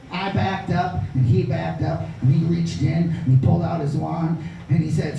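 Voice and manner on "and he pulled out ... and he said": usual speaking voice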